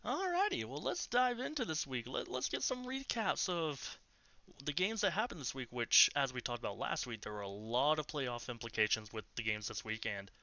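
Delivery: bright, high host segue; show host voice. Acted. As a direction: kick off the segment like a host. Segment host intro.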